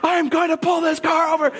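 high-pitched mock shout; hammed-up fatherly outburst; frustrated dad. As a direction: yell it like a dad at the end of his rope. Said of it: "My dad's frustrated".

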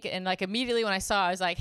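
ordinary talk

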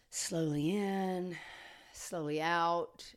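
slow, soft breathing cue. Shown as a demonstration. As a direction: draw each word out like a deep breath. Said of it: "he feels that deep breath".

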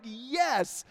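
high, drawn-out mock call; retold calling back. Neighbor calls permission back to the dog walker, acted calling. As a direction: call a yes across the distance.

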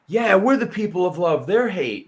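hammed-up mock hypocrite; mocking impression. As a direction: parody them with a shrill sneer. Mimicking the group's claim.

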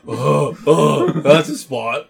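low monotone moan. Pretend. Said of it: "that low monotone voice".

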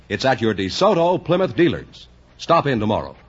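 ordinary talk

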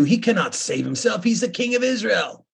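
a mild mock yell of the scoffers; mocking shout. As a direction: scoff at him across the crowd. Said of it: "they mocked him saying".

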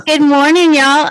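loud, laughing good morning; cheerful greeting. Real, not acted. Greeting the podcast listeners on request, loud and laughing. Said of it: "greet us".

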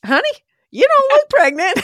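high, loud teasing nurse; hospital nurse teasing the visitor, high and loud. Retold or imitated. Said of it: "And she goes".

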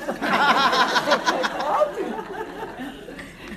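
loud rising burst of laughter; real laughter; hearty laugh. Real, not acted. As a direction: let out a big laugh. Big laugh at story.

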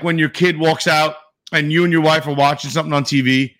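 ordinary talk, nothing marked

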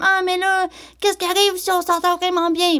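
high, whiny imitation of a date. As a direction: squeak it out like an anxious guy. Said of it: "Ils ont-tu toute cette voix-là?".

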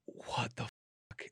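slow, whispered retold shock; stunned whisper. Reacting to mom's news about his daughter, slow stunned whisper. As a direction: whisper it slowly, as if dumbstruck.

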